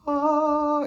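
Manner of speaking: very high, loud held "ah"; sustained high-pitched vocal flourish. Acted. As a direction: hold a long, mock-saintly high note. Claims to be a saint.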